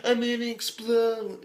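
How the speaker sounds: high, strained, hammed-up villain voice; mock unhinged villain. Quoting the anime villain to viewers, high and hammy. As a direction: overact a freak about to burst. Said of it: "this dude's like".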